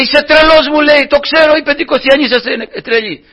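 high, loud scoffing voice; mocking heckler. Heckler scoffing at the preacher, high and loud. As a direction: sneer at the crazy Pentecostals.

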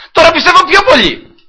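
very high, loud cry of faith; exultant declaration. Retold or imitated. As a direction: top your own answer at full voice. Retold proud answer to friend. (θριαμβευτική κραυγή πίστης)

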